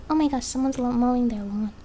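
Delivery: normal talking voice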